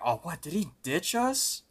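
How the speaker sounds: rising, high-pitched incredulous question; retold disbelief. Looking around for missing Cart, mock incredulous. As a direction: stretch it out, climbing high at the end. Retold quote, friends abandoned.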